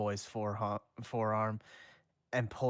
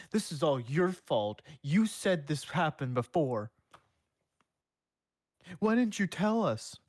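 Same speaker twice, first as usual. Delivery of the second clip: higher, louder mock accusation; accusing townsfolk; 6 semitones higher, 5 dB louder than usual. The townsfolk blame the one who warned them, mock accusing. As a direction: blame him, voice rising to a shout. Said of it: "they pop out of nowhere and say".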